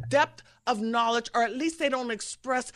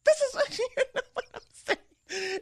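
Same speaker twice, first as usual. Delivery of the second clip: laughing. Real, not acted. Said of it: "are you laughing".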